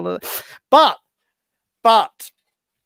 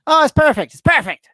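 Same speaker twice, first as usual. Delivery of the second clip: a hint of chirpy Carson, higher; mild Carson voice; 11 semitones higher, 6 dB louder, faster than usual. Carson tells Tarrant on the phone, in a lightly put-on chirpy voice. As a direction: lightly play the comic on the phone. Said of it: "Tarrant rings Carson and says".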